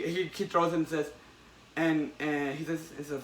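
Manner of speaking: ordinary talk, nothing marked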